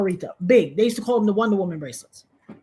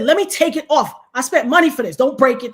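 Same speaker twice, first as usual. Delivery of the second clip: louder, higher indignant protest; angry protest; 5 semitones higher, 5 dB louder than usual. Retold or imitated. Arguing with the thief, louder angry protest. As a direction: argue to save the chain. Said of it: "I said".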